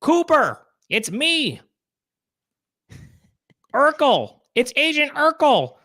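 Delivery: very high, squeezed nerd voice; Steve Urkel. Acted. Doing the director as a sitcom nerd, very high put-on voice. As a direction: push it way up high and hold it there. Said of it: "just do an impression of Steve Urkel".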